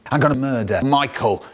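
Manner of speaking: normal talking voice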